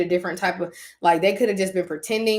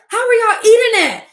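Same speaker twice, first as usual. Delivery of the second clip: much higher, loud disbelieving cry; disbelief; 10 dB louder than usual. Retold or imitated. Asking her family how they eat the pizza, loud disbelief. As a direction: squeal in disgusted disbelief.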